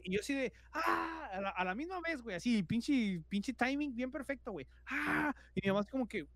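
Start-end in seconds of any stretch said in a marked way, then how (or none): 0.7-1.3 imitated terrified scream. Boy screaming as mother opens the door, imitated. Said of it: "grite y grite y grite". 4.9-5.3 high-pitched mock scream; imitated terrified scream. Boy screaming as mother walks in, imitated. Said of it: "grite y grite y grite".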